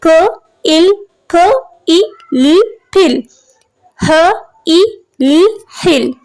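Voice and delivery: "फ, इ, ल, फिल" sounded out one sound at a time, then blended; sounding out words. Shown as a demonstration. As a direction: break "हिल" into ह, इ, ल, then say it whole. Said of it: "middle में देखिए ये sound आ रही है".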